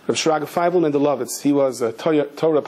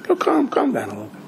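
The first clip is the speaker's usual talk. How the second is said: imitated soothing old lady; 8 semitones higher than usual. Old lady to angry cop, imitated soothing. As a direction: gently hush him in a lightly put-on voice.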